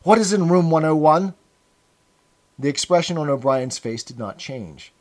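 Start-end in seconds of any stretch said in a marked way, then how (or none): 0.0-1.4 bursting out higher and louder; blurted out. Acted. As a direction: let the question burst out of you. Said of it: "the words burst out of him".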